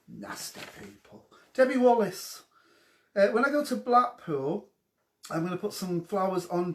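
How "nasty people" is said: breathy aside under the breath; whispered jab. Real, not acted. Teasing viewers who judge her, whispered. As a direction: whisper it as a sly dig.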